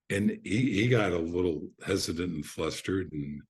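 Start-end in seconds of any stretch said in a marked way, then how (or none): none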